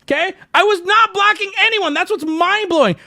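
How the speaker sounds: high, loud outraged protest; indignant denial. Real, not acted. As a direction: insist hard that you did nothing wrong. Denies blocking anyone, indignant.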